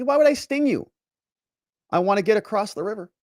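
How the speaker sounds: high-pitched mock-innocent protest; protesting scorpion. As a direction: play the scorpion insisting it means no harm.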